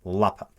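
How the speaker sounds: Mlapamp clipped into one word; acronym as word. Shown as a demonstration. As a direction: say Mlapamp as a quick single word. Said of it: "that's what it would sound like".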